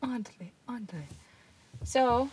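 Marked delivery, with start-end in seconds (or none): 0.0-1.0 whispered. Acted. Echoing the joke name.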